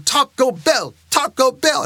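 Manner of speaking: insistent mock chant; kids crying out. Kids together demand lunch from dad, imitated crying out. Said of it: "crying it out louder and louder".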